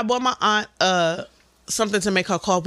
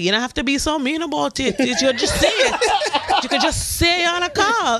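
a hint of a high, whiny aunt; lightly voiced offended elder; mild mock hurt complaint; 5 semitones higher than usual. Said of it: "They be like".

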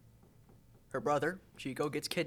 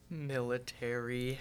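ordinary talk, nothing marked

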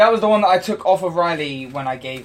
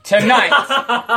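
ringmaster; 8 semitones higher, 7 dB louder than usual. Pretend. Continues showman intro.

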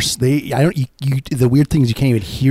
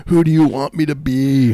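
higher, louder mock wail; mock crying kid; 6 semitones higher, 5 dB louder than usual. Friend echoing the disturbed boy, higher mock wail. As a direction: echo the kid's wail.

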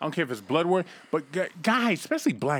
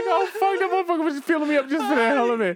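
mock tearful whine; 12 semitones higher than usual. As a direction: wail like a betrayed man in tears. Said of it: "He's like".

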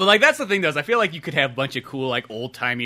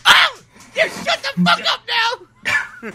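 very high screeched Sherlock rebuke; mock furious screech; 6 dB louder, much slower than usual. Sherlock silencing whining Watson, hammed-up screech. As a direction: screech him down, drawn out, half laughing.

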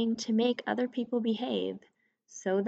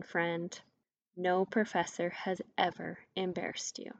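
ordinary delivery

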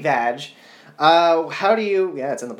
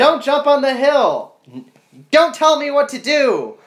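mock furious shout; 11 semitones higher, 7 dB louder than usual. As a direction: bellow it like a melodramatic duel. Acted Star Wars duel quarrel.

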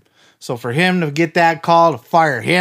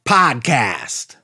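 high, slow hyped announcer read; announcer intro; 6 semitones higher than usual. Acted. Host opening the wrestling podcast, announcer hype. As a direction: sell the show like a big promo.

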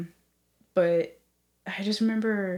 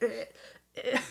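choking; 3 semitones higher, 5 dB quieter than usual. Retold or imitated. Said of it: "I'm literally like".

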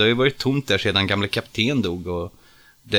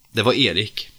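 usual speaking voice

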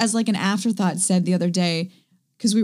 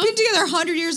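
high, disbelieving question; high, mock outraged disbelief; incredulous; 9 semitones higher than usual. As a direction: quiz him like he's a stranger. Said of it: "I was like".

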